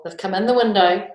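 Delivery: plain reading voice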